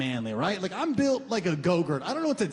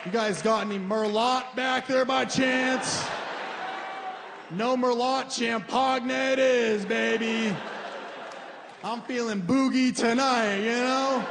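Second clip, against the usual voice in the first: high, slow swagger; ordering champagne and steak to impress his date, higher and showy; boastful big spender; 5 semitones higher than usual. Pretend. Showing off ordering pricey food.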